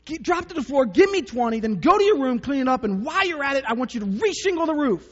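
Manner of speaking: high, loud hammed-up barking orders; exaggerated bossy dad piling on orders; mock drill sergeant dad. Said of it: "And I go".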